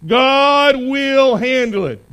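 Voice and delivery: loud, slow, every word weighted; emphatic declaration. Shown as a demonstration. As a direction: proclaim it for the church to echo. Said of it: "It's one more time".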